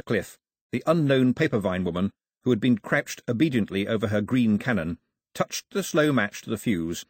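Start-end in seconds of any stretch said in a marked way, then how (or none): none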